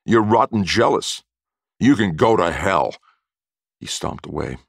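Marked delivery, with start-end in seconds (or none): 0.0-3.0 louder bitter accusation; bitter, raised-voice outburst. A narrator's reading. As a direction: throw the insult at him with force. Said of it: "Oscar said bitterly".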